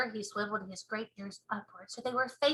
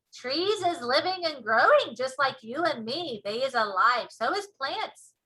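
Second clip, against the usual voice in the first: BFG giant voice; 3 semitones higher, 7 dB louder than usual. Acted. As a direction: show off the ears proudly.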